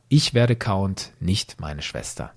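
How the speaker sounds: ordinary talk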